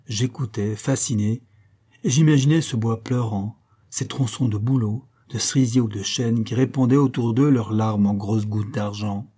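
ordinary delivery, nothing marked

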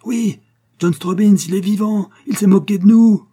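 high, loud, indignant shouting; frantic outburst. A narrator's reading. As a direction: cry out the scandal at the top of the voice. Frantic chief announces the hoax. (cri indigné, très aigu et fort)

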